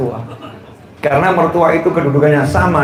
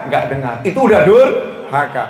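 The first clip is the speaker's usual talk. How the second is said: ordinary talk, nothing marked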